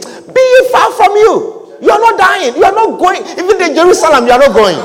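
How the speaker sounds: high, loud forceful rebuke; Peter's rebuke. Acted. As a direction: forbid him from going. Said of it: "he rebuked him".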